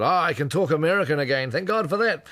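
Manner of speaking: mock American accent. [name] on the last day, out of character, in a mock American accent. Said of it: "converted into American accent".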